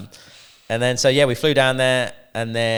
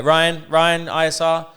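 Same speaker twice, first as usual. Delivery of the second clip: calling out; 7 semitones higher, 6 dB louder, slower than usual. Retold or imitated. Runner calling him urgently.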